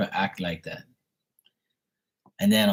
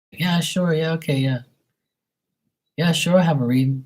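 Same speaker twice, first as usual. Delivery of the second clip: higher-pitched fake agreement; leading them on; 8 semitones higher, 3 dB louder than usual. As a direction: play along with mock willingness. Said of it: "I lead 'em on too".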